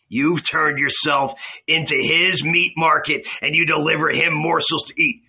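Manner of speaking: ordinary delivery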